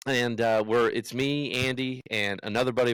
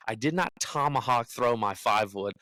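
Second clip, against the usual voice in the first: usual speaking voice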